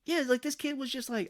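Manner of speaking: ordinary talk, nothing marked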